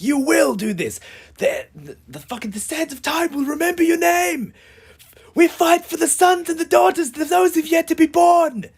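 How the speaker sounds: rally the troops like a hero; epic rallying speech. Acted. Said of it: "get all amped up and write my big paragraphs".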